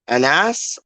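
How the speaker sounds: Anas said slowly as a-NASS; second-syllable stress. Shown as a demonstration. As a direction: draw out Anas, leaning on the final NASS. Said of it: "So is it".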